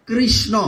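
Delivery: কৃষ্ণ said clearly as krishno with the ri; correct pronunciation. Shown as a demonstration. Preacher teaches the congregation the proper krishno. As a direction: sound the r in কৃষ্ণ carefully. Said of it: "কৃষ্ণ শব্দটা হচ্ছে".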